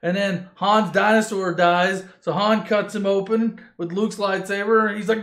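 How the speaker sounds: ordinary talk, nothing marked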